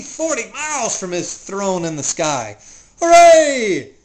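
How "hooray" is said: very loud, high cheer; joyful shout. Acted. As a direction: yell out in triumph. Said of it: "shouted Yertle".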